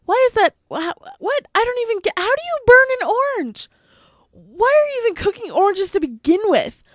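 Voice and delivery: high-pitched sputtering incredulity; baffled disbelief. Pretend. Vlogger questions how anyone burns an orange, comically baffled. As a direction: stumble over the words, voice shooting up in mock bafflement. Mock outrage at burnt orange.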